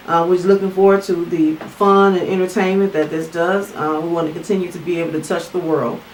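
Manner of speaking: prayer. Real, not acted. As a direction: pray grace over the meal. Saying grace.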